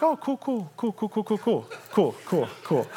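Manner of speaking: softly repeated, falling away in pitch; awkward, hushed filler from someone stalling; awkwardly casual. Acted. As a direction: start high and soft, let it sink. King feigning casual interest, awkwardly.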